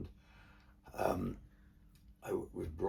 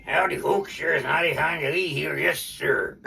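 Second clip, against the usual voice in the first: Hank the cowboy; 10 semitones higher, 14 dB louder than usual. Retold or imitated. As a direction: boom it out like a ventriloquist's cowboy dummy greeting the crowd. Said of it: "Hank used to say".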